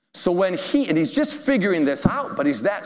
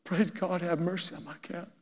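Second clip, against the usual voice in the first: softly, near tears, much quieter; hushed and teary, pleading for the cat; misty-eyed; 3 semitones higher, 9 dB quieter than usual. Retold or imitated. Cat owner tells the pastor her prayer, softly and teary. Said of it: "she got all misty-eyed".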